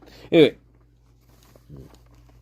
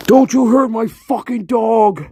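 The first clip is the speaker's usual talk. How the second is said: put-on high, loud surfer-accent shout; Buffalo Bill; 11 semitones higher, 5 dB louder than usual. Movie killer warning about his dog, high strange-accented shout. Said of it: "Think about that accent, Buffalo Bill".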